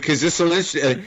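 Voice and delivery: ordinary talk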